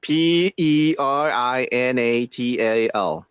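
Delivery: slow, low letter-by-letter spelling. Real, not acted. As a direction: spell it out evenly, drawing out each letter. Spells the word out for co-host.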